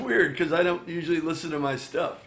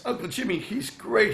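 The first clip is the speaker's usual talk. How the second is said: higher-pitched gushing praise; imitated eager niece; 7 semitones higher than usual. Niece vouching for the singer to her uncle, imitated enthusiasm.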